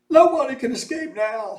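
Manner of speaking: high, loud despairing voice; despairing cry. A narrator's reading. A bystander cries out in despair, high loud voice. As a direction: cry it out in dismay. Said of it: "someone cried".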